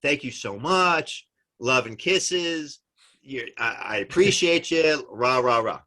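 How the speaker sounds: high, slow, syrupy gushing; mock-sweet thank-you note. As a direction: lay the flattery on thick.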